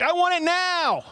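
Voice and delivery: high, shrill spoiled-brat demand; Veruca Salt yelling. Retold or imitated. Spoiled rich girl demanding a bean feast, high yell. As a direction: stamp your foot like a spoiled brat. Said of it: "and she yells".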